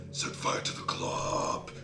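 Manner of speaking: slow, voiceless stage whisper; drawn-out whisper. Pretend. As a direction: whisper it, stretching FIRE out. Same phrase repeated without voice.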